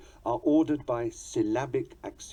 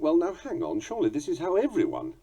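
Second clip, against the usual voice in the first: higher-pitched imagined protest; reader's objection; 7 semitones higher than usual. Acted. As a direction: break in with a doubting objection. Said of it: "You may think".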